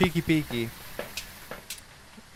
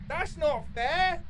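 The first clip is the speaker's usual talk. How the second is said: whine; 6 dB quieter, much slower than usual. Real, not acted. Whining at the game.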